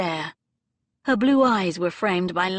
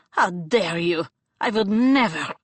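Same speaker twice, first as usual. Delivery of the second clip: outraged; 5 dB louder than usual. Acted. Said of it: "gasped in outrage".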